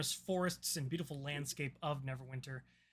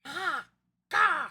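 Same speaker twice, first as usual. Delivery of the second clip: shrill squawking raven call; raven caw; 11 semitones higher, 10 dB louder than usual. Acted. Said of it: "That's my best raven impression".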